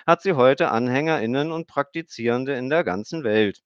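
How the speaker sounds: put-on high girl reciting by rote; a child parroting a memorized text; recited lecture. A narrator's reading. Emmy recites her mother's method to Stefan, high rote voice. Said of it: "schloss den Vortrag".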